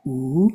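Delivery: Oo. held out long, rounded lips; prolonged oo. Shown as a demonstration. Teacher demonstrating the second syllable of Om, prolonged oo. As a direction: draw out Oo. as in roof. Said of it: "but prolonged".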